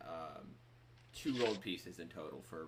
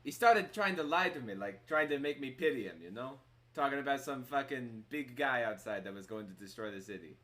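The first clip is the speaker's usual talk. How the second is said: put-on lightly accented, scornful innkeeper; disbelieving barkeep in character; Bruin; 5 semitones higher, 6 dB louder than usual. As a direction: start high and loud, scoffing at a tall tale. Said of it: "that horrible accent you're doing for Bruin".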